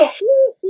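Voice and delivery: loud, high witchy cackle; put-on high nasal laugh; nasally witch cackle. Shown as a demonstration. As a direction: cackle through the nose like a witch. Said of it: "laughing in this voice".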